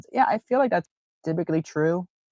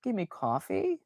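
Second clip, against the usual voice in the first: much softer, halting and amazed; stunned quiet disbelief; 4 semitones higher, 10 dB quieter than usual. Retold or imitated. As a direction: gasp it out, unable to believe it. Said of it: "like, like".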